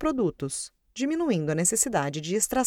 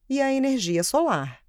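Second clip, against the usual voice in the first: normal talking voice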